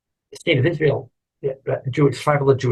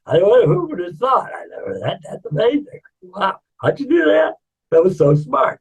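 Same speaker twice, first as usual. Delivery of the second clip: high-pitched, gushing mock amazement; amazed; 8 semitones higher than usual. Onlookers praising the baker's bagel, amazed. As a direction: gush with hammed-up wonder.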